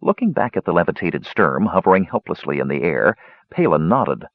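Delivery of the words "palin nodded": usual speaking voice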